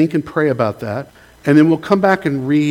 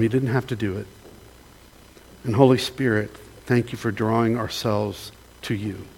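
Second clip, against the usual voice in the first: closing prayer; 3 semitones lower than usual. Acted. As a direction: pray humbly, letting the voice sink by the amen.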